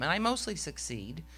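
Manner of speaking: ordinary talk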